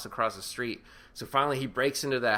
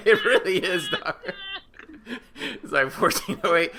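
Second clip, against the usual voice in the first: laughing; 7 semitones higher, 6 dB louder than usual. Real, not acted. Laughing at the movie comparison.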